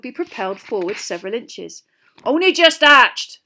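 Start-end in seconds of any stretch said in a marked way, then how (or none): 2.2-3.4 loud, high, drawn-out boast; proud. A narrator's reading. Hagrid showing off his hatchlings, loudly proud. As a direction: boom it out like a proud parent. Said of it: "said Hagrid proudly".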